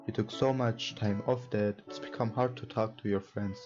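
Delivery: ordinary talk, nothing marked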